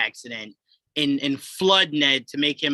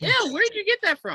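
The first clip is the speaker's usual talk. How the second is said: very high squeaky imitation of a puzzled question; mock puzzled voice. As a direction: squeak it out like a baffled cartoon. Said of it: "It's like".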